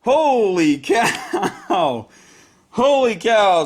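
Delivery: drawn-out, high, laughing amazement; real giddy astonishment with a laugh; amazed elation. Real, not acted. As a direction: stretch it out in thrilled disbelief. Stunned by winning dice roll.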